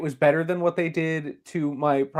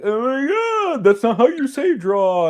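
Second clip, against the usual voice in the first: high, slow, mocking voice of a doubting crowd; mocking scoffers; 11 semitones higher, 6 dB louder, slower than usual. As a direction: mimic people scoffing at him. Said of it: "people are like".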